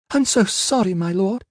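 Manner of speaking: put-on very high, timid servant girl; frightened young slave's small high voice; timorous. A narrator's reading. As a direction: apologise meekly, pitched far up. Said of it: "a timorous voice intruded".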